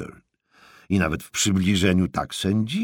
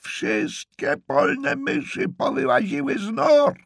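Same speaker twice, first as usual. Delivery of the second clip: shrieked list of omens, voice pitched way up; yelling in his ear; 5 dB louder than usual. Acted. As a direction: screech each sentence for a deaf man. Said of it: "żeby wrzeszczeć mu w same ucho".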